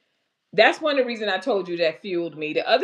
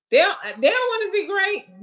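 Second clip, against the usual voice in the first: mock complaint; 11 semitones higher, 3 dB louder than usual. Real, not acted. Mock conspiracy about tech.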